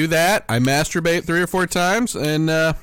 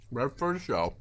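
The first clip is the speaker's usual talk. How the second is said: choking on candy mid-sentence; choking on Snickers; 4 semitones lower, 11 dB quieter than usual. Real, not acted. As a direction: choke on a bite of Snickers. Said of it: "I'm choking on some Snickers now".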